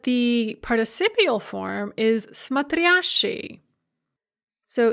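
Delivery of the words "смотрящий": slow смотрящий, stressed on the second syllable; accent the ря in смотрящий; stress as in infinitive. Shown as a demonstration. Teacher gives the participial form смотрящий, stress on ря. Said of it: "stress is the same as it is in the infinitive".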